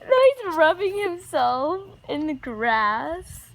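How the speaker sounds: usual speaking voice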